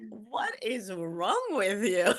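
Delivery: slow, drawn-out mock exasperation with laughter; retold exasperated scolding. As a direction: drag out each word in amused disbelief. Exasperated wife scolding starstruck husband.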